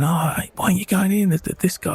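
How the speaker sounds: imitating people calling him into the disco; passers-by calling. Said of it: "people walking past us saying".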